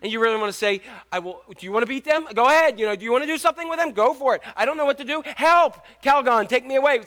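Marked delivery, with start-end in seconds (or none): 1.1-7.0 high, loud mock-desperate plea; frantic exasperation. Parent to staring onlookers in store, frantic exasperation. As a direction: throw up your hands and beg for rescue. Acted parent at wits' end.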